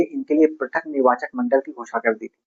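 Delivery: ordinary delivery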